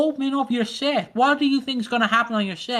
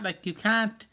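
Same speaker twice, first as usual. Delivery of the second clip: ordinary talk, nothing marked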